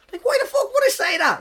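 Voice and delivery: incredulous. Real, not acted. Comic baffled by what he told the girls, incredulous. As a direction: squeak it out, baffled at yourself. Baffled at his own remark.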